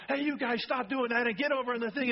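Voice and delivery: imitating a mom's high, loud yell at her kids. Pastor imitates his mom yelling at the kids, high and loud. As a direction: bark it like a fed-up mother. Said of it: "My mom would be yelling".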